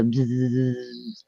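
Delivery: low electrical buzzing noise; imitated buzz. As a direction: make a droning electric bzzz. Imitates electrical buzzing she felt.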